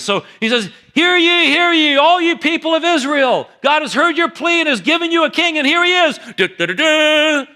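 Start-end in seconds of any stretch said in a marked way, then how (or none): 0.9-6.2 much higher, louder herald's call; royal proclamation. Acted. Samuel proclaiming a second time. 6.4-7.5 loud, high, slow trumpet fanfare; hummed fanfare. Acted. Fanfare again.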